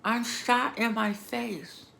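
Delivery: shouting back at the rude paramedic, furious; retold furious outburst. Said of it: "I'm like".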